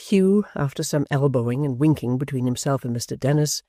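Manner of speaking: plain reading voice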